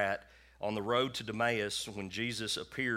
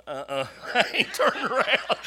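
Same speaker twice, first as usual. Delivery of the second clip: high, loud, hammed-up scared refusal; mock terrified squeal with laughter; too afraid to look; 13 semitones higher, 11 dB louder than usual. Scared man in bed refuses God, hammed-up high squeal. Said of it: "I'm laying there in bed going".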